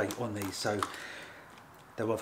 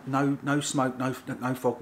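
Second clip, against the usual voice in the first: ordinary delivery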